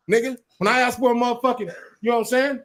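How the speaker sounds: normal talking voice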